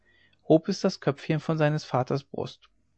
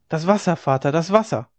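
put-on child's alarmed cry, slightly raised; calling out; 4 semitones higher, 4 dB louder than usual. A narrator's reading. Frightened child on the dike to father, calling out. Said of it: "rief sie".

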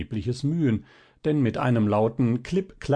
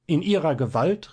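higher, indignant question; angry outcry; 6 semitones higher, 3 dB louder than usual. A narrator's reading. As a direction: fling it back at him in anger. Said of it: "rief das Fräulein zornig". (zornig, empört und höher)

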